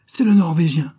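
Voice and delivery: s'écrier brusquement, plus haut et plus fort; cri brusque. A narrator's reading. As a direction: lancer la nouvelle d'un coup, fort. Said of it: "s'écria brusquement". (exclamation brusque et forte)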